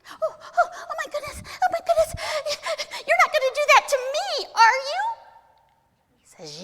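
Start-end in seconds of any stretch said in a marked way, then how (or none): 0.1-5.0 very high, loud squealing plea; panicked little goblin. Acted. Little goblin fears being crushed, high panicked squeal. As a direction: squeal in terror, hammed-up.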